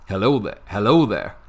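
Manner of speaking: a hint of a put-on American accent. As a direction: greet them like a cheesy American. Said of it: "can you do an American accent".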